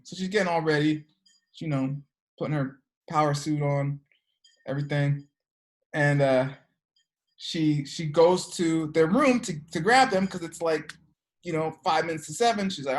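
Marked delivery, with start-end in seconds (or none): none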